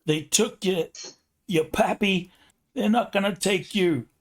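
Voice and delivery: put-on defiant mom in heavy New York accent. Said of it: "In a super thick New York accent".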